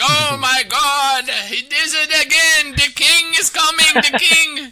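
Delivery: high, drawn-out mock yell; yelling Chinese commentator. Friend mimicking the Chinese streamer, high drawn-out yelling. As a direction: imitate an overwrought caster hollering at full pitch. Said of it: "He just starts yelling out shit".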